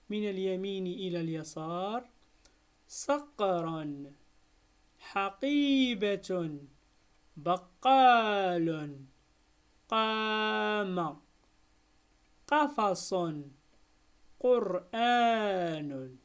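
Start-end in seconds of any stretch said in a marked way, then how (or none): 2.9-13.1 each word drawn out slowly and louder; slow careful reading. Shown as a demonstration. Teacher to pupils, model reading, slow and loud. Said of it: "نمر الآن إلى قراءة الكلمات". 14.4-16.2 each word drawn out slowly and louder; slow careful reading. Shown as a demonstration. Teacher to pupils, model reading, slow and loud. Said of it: "نمر الآن إلى قراءة الكلمات".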